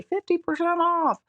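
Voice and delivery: high-pitched discount pitchman; Crazy Eddie. Shown as a demonstration. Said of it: "I sound like Crazy Eddie when I say".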